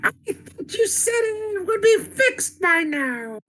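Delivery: very high, slow screechy villain impression; hammed-up falsetto robot villain demanding answers; Starscream. Co-host reads villain's lines to Soundwave on request, screechy impression. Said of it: "I need you to do it. Read the lines".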